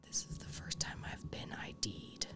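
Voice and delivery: hushed whisper, no voice. Retold or imitated. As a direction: whisper it like a secret. Retold quote of brewer, whispered.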